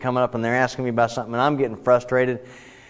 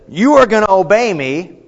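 louder, higher commanding order; domineering boss; 4 semitones higher, 6 dB louder than usual. Acted. Someone demanding obedience from underlings, domineering. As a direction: lay down the law, loudly. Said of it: "this is not someone that".

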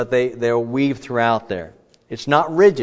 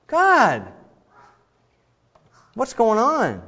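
higher, pleading question; anguished question to God; 9 semitones higher, slower than usual. Shown as a demonstration. Psalmist questioning God, higher and pleading. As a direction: ask God in bewilderment. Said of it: "not when you stub your toe".

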